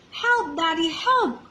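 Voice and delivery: high, alarmed cry for help. Acted. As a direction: call out, stuck again. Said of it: "cried Little Squirrel".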